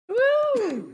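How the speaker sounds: high whoop to finish the song; excited woo. Pretend. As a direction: cheer at the end of the jingle. End of sung jingle.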